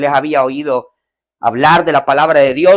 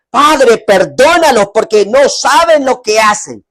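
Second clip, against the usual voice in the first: tender plea; 5 semitones higher, 4 dB louder than usual. Acted. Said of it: "el Señor dijo".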